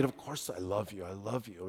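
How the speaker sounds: very soft, low imitated avowal; hushed reply. Peter answers Jesus's question about love, hushed low avowal. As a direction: drop right down, reassuring quietly.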